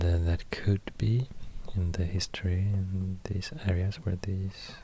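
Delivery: ordinary talk, nothing marked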